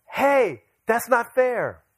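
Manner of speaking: indignant child imitation, high and loud; mock-outraged little kid, drawn out; [name]. Son echoes his sister's protest.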